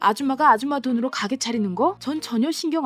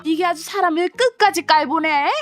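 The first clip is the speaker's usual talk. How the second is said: higher, louder stepmother threatening; furious stepmother; 5 semitones higher, 5 dB louder than usual. Acted. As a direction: threaten to tell her father.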